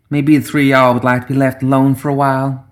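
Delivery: light, mocking lilt without real laughter; teasing giggle. A narrator's reading. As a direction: tease them in a lifted voice. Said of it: "giggled Ophelia".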